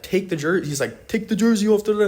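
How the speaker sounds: imitating the stranger demanding the jersey off; mock angry demand. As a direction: bark it like the angry local.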